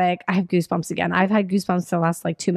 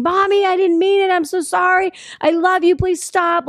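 screaming plea; 9 semitones higher than usual. Retold or imitated. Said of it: "he's screaming".

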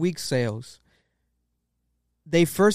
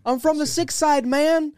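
put-on high-pitched, playful Canadian slang accent; hammed-up Canadian accent; mock Canadian accent; 12 semitones higher, 4 dB louder, slower than usual. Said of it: "turn on my Canadian accent".

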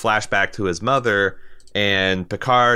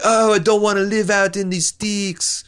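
mock whine; 10 semitones higher than usual. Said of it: "who the fuck would be like".

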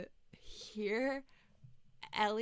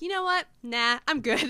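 dismissive; 6 semitones higher, 10 dB louder than usual. Retold or imitated. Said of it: "it's like".

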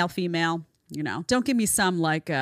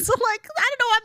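much higher, laughing bafflement; baffled laughing; 3 dB louder, faster than usual. Real, not acted. Host reacting to a clip she cannot believe, baffled laughing. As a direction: laugh through it, pitch shooting right up.

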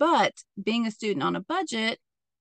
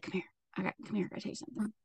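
hushed, much softer secretive mutter; whispering; 12 dB quieter, much faster than usual. Retold or imitated. David luring Mary aside at the yard, whispering. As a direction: imitate someone furtively calling a person over. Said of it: "Why are you whispering?".

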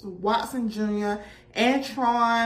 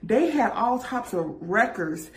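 normal talking voice

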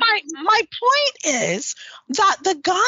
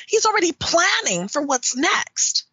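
usual speaking voice

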